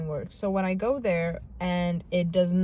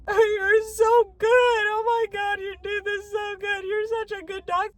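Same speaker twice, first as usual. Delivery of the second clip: high-pitched tearful wail of praise; mock crying; 11 semitones higher, 4 dB louder than usual. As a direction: imitate yourself sobbing out compliments, loud then easing. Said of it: "I was sitting there crying".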